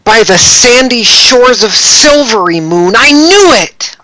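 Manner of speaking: high, rising outburst; acted exclamation. Brandy learning a customer has a thing for her, exclaiming high. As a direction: cry out as if proven right.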